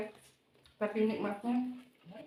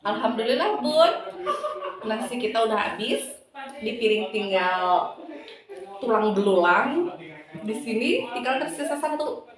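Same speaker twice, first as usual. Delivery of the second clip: loud, upbeat host sign-off; lively vlog outro; 7 dB louder than usual. Acted. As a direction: wrap up the video for viewers. Energetic outro to viewers. (penutup vlog yang ceria dan lantang)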